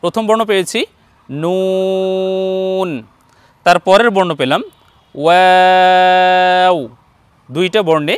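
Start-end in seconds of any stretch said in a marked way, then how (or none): 1.3-2.9 নুন held long with rounded lips; slow, round-lipped নুন. Shown as a demonstration. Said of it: "ঠোঁট গোল করতে হবে". 5.1-6.8 ওয়াও drawn out, lips rounded; teacher modelling the letter slowly. Shown as a demonstration. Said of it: "সদা সর্বদাই ঠোঁট গোল করতে হবে".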